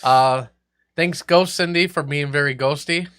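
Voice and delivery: ordinary delivery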